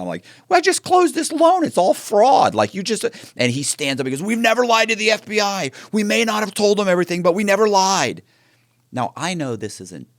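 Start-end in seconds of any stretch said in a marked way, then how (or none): 0.5-3.0 raised, high-pitched argumentative outburst; heated retort. Retold or imitated. Confronting the husband about the fraud, heated retort. 4.2-8.2 high, loud mock outburst of denial; indignant protest. Husband stands up denying he lied, indignant protest.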